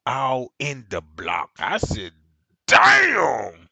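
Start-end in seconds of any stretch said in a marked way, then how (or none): 2.7-3.7 a big drawn-out amazed damn, loud; loud stunned damn. Retold or imitated. Impressed by her engine talk, a loud amazed damn. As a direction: blown away, let it ring out.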